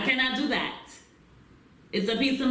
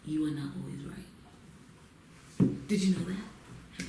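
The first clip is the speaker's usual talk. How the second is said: hushed and slowed, like leaning in to confide; conspiratorial hush; secret; 6 semitones lower, 6 dB quieter than usual. Pretend. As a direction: drop low and soft, slow, sharing a secret. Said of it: "Let me tell you a secret".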